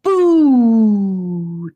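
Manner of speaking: long drawn-out booming boo, loud and high; loud high boo to show off the echo; loud high-pitched boo. Acted. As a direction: hoot it slowly into the cave to hear it echo. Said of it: "a loud, high-pitched".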